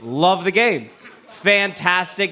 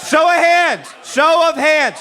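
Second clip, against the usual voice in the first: calling out; 9 semitones higher, 4 dB louder than usual. Real, not acted. Calling out to the crowd.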